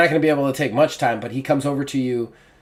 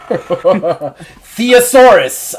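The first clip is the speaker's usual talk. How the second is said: laughing; 5 semitones higher, 10 dB louder, much slower than usual. Real, not acted. Laughing over a made-up word.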